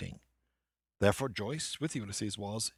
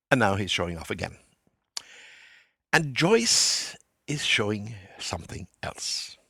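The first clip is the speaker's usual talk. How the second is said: ordinary talk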